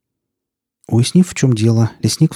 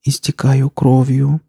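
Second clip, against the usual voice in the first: put-on high, slow, plaintive girl's voice; weak voice; 5 semitones higher, slower than usual. A narrator's reading. Injured girl telling the forester she is bleeding, in a weak voice. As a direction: draw it out high, as if failing. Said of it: "сообщила Настя слабым голосом".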